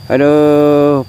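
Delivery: pained exclamation. Real, not acted. Exclaiming at the heat.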